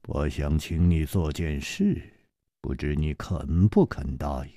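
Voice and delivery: low, soft, measured request; 楚留香 asking calmly. Acted. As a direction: ask quietly and steadily. (楚留香低沉平静地请求)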